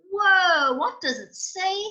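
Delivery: loud, high whoa; real amazement; surprised. Real, not acted. Reader amazed at the giant statue, loud whoa.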